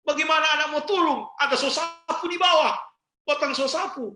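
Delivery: mock angry mother. Mother scolds her son up a tree, mock furious yell. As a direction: yell the kid down from the tree, broom in hand.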